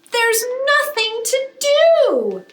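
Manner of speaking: high, slow whine of boredom; whiny bored child.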